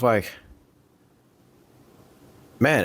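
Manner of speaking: normal talking voice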